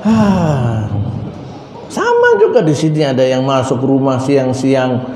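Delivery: normal talking voice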